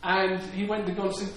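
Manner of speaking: usual speaking voice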